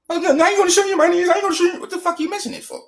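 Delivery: fast, very high falsetto imitation; rapid falsetto mockery of an opponent; mock protest. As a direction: mimic a flustered refusal in a squeaky voice. Acting out opponent's refusal in argument.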